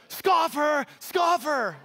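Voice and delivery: playful high shout of Scoffer; mock taunting shout. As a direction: call it out like a teasing kid. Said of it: "just go".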